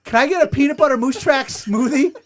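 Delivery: high, loud, eager order. Retold or imitated. Ordering a smoothie once the trainer leaves, eager and loud. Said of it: "I go".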